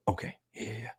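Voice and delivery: unvoiced timid whisper; nervous whisper. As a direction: barely breathe it out, nervous. Anxious kid quoted.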